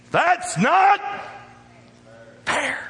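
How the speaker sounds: a cry of "not fair" from an indignant churchgoer; mock whiny protest. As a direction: whine it like a wronged kid. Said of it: "we might say this".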